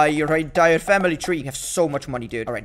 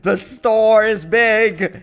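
slow, drawn-out awe; hammed-up triumphant wonder; awestruck; 3 dB louder, much slower than usual. Real, not acted. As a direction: stretch every word in grand amazement. Marvels at the upgraded store.

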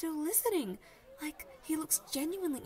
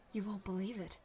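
usual speaking voice

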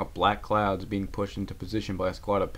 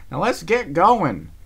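higher, louder, slow order; no more arguing; firm command; 10 semitones higher, 9 dB louder, much slower than usual. Acted. Applejack orders Rarity to move on.

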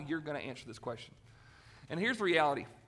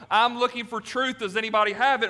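seeker voice; 6 semitones higher, 8 dB louder than usual. Retold or imitated. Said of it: "stumble in here one Sunday and go".

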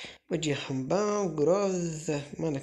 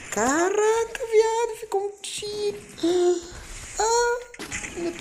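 shrieking high yell; real high-pitched gamer yell; 4 dB louder than usual. Real, not acted. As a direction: screech in sudden frustration. Missed kill in a firefight.

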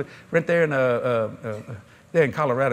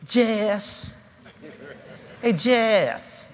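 higher, sweet calling voice; a light, higher imitation of a sweet wife calling; Gloria calling; 5 semitones higher, much slower than usual. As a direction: call him sweetly like Gloria. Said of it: "So she goes".

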